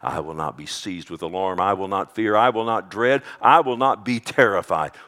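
bold declaration. Acted. Boldly declaring the promise.

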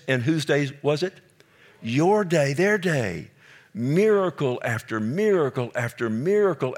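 high, loud, driving sermon climax; full-force preaching. Acted. Pastor pressing the congregation about Jerusalem, loud and high. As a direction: build the lament to a ringing peak.

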